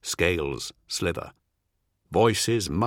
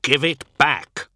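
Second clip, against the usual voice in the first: raised, insistent demand; high, forceful demand; shout; 6 semitones higher, 6 dB louder than usual. A narrator's reading. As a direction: shout the demand, pitched up and loud. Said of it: "Faust shouts".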